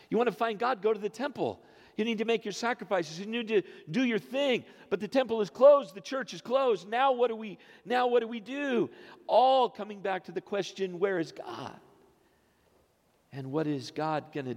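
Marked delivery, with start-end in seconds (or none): 0.1-11.8 higher, urgent pulpit preaching, building to a louder peak; fervent preaching. Acted. Preacher to congregation, raised and urgent preaching. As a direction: drive the congregation toward the question.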